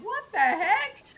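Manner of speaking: high, loud baffled outburst; bewildered. Retold or imitated. Her baffled reaction to a stranger's compliment, high and loud. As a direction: blurt it out, totally thrown.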